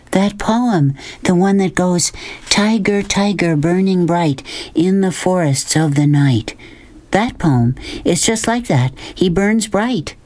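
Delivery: put-on a girl half-reciting Blake in wonder; lightly voiced girl Sistine quoting the tiger poem; Sistine, awed. A narrator's reading.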